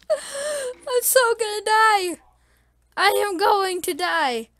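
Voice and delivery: high, loud, drawn-out despairing cry; panicked wail. Real, not acted. Gamer about to be killed by mobs, loud high wail. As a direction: wail that you are doomed.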